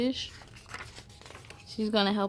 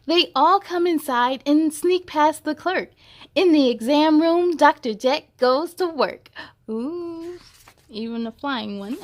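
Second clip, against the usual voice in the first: storybook narration; 3 semitones higher, 5 dB louder than usual. A narrator's reading. Reading a vet story aloud to kids, lively storybook narration. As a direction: read it like a bedtime picture book.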